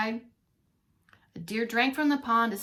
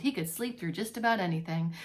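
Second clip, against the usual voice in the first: usual speaking voice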